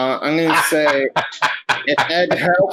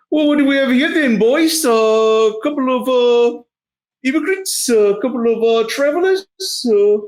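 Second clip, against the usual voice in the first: high-pitched, hesitant shakedown voice; shady guard; 11 semitones higher than usual. Acted. Guard stopping travelers at the gate, high put-on voice. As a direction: play a nosy border guard, pitched way up.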